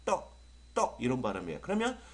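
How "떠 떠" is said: 떠 as one short isolated burst; TH sound demo. Shown as a demonstration. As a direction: pop out a short 떠, then pause. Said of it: "요런 발음이라겠죠?".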